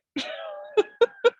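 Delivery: high, rippling real laugh; amused laugh. Real, not acted. Teacher amused by the body called meat puppet, real laugh. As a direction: laugh helplessly at an absurd phrase. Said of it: "except to laugh at it".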